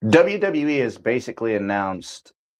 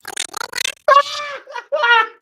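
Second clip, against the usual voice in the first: high shrieking laugh; helpless burst of laughter; hard laughter. Real, not acted. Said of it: "I didn't mean to laugh that hard".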